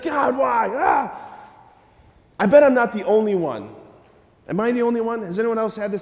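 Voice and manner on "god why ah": mock exasperated cry. Preacher acting out a frustrated prayer, mock cry. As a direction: throw up your hands and cry out why. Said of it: "You're like".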